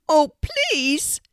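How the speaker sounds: high, loud bird voice; a pleading talking raven. A narrator's reading.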